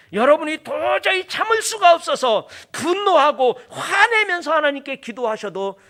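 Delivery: loud, high outburst from the pulpit; impassioned preaching. Real, not acted. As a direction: preach with heated force.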